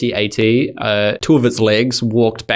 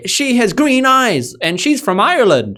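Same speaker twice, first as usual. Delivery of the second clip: mock hero reply; 11 semitones higher than usual. Cohost parodying Tom rejecting the villain, hammy and high. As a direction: declare love for the redhead like a corny hero. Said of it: "And then Tom says".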